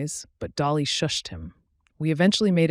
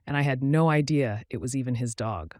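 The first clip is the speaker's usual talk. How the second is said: ordinary delivery, nothing marked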